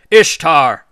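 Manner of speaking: very loud, sharp exclamation; shocked shout. A narrator's reading. Anu appalled at his daughter's threat, shouting. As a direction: cry out her name to stop her. Shocked father cries out her name.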